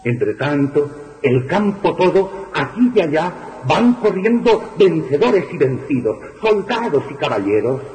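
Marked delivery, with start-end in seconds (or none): none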